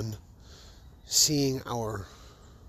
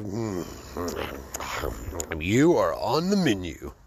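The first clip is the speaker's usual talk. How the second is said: slow, savoring "you are on the menu"; lightly voiced hungry diner eyeing dirt; mock hungry; 5 semitones lower, slower than usual. Speaker to sand and dirt, mock savoring slow. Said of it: "dirt and go".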